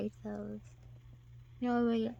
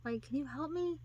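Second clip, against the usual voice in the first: mock stuck lady; 6 semitones higher, 8 dB louder than usual. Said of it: "you're going".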